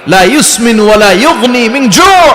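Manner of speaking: high, slow Arabic declamation; impassioned preacher quoting scripture; forceful verse recitation. Real, not acted. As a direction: ring the verse out, stretching the last word.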